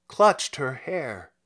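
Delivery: ordinary delivery, nothing marked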